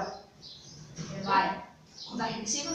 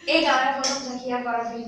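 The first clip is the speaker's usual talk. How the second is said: vlog host; 7 dB louder, faster than usual. Real, not acted. Host addresses viewers.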